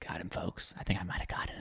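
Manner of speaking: hushed mock aside to listeners; soft, conspiratorial whisper; stage whisper.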